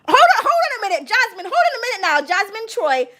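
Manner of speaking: high, fast disbelieving hold on; incredulous. Real, not acted. Streamer stopping a viewer's story in high disbelief. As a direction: stop her mid-story in disbelief.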